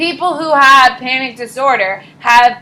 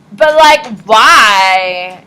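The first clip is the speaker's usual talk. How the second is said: clueless peer; 9 dB louder, much slower than usual. Retold or imitated. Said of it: "they're gonna be like".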